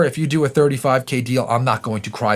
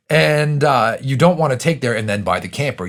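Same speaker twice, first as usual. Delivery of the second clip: ordinary talk, nothing marked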